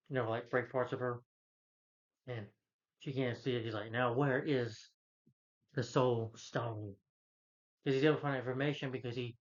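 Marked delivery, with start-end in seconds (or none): 3.9-7.0 drawn-out, deliberate villain questioning; slow interrogation. Retold or imitated. Villain questions captive about the Soul Stone, slow interrogation. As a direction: stretch each word out as a slow demand.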